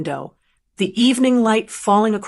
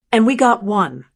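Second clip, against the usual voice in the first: ordinary delivery, nothing marked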